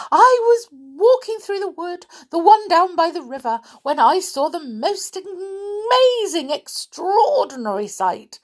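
high, breathless excited gush; Little Miss Chatterbox, very excited. Acted. Chatterbox telling her friend of an amazing sight, very excited. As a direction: pile it on, bursting to reach the big news. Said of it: "a very excited little Miss Chatterbox".

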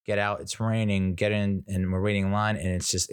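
usual speaking voice

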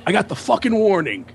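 high-pitched indignant tirade, building; wrestler-style rant. Retold or imitated. Host berating strangers drinking his beer, ranting angrily. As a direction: act out a host throwing everyone out, wrestling-promo style. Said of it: "you get all W W F crazy".

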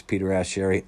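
usual speaking voice

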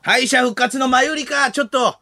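high, projected comic order; hammed-up host calling offstage; mock summons. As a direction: call the act over in mock desperation. Plays host summoning another act.

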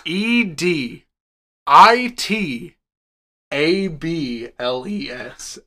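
spelling it letter by letter; slow letter-by-letter spelling; spelled out. Shown as a demonstration. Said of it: "Editables, bro".

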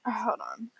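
quick hushed whisper; whispered aside. Real, not acted. Girl hushing her friends mid-recording, whispering. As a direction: whisper it so the mic barely catches it. Aside while others talk.

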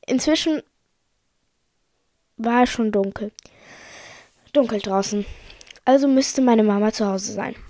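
usual speaking voice

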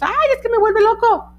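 exasperated owner. Retold or imitated. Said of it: "nos decimos".

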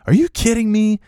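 high-pitched mock outrage; irate. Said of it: "he just starts getting irate".

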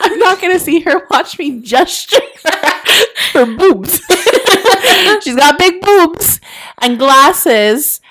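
laughing through the words; giggling while talking. Real, not acted. Laughing mid-story.

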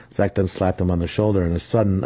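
plain reading voice

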